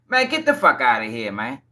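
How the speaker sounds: low disbelieving brush-off; scoffing dismissal. Real, not acted. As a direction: wave the lawyer's claim away. Scoffing at lawyer.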